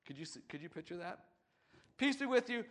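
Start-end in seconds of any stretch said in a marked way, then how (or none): 2.0-2.6 a loud, higher call of peace; Jesus greeting. Retold or imitated. Preacher voicing Jesus greeting the disciples, louder and higher. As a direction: greet them like a dad walking in.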